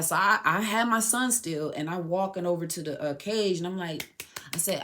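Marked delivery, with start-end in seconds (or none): none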